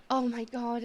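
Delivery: ordinary talk, nothing marked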